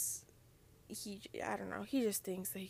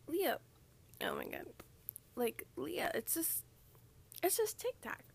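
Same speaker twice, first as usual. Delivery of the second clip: higher, whiny imitation of a friend; mocking exasperated friend; 7 semitones higher than usual. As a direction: mimic an eye-rolling friend. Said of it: "And you're probably like".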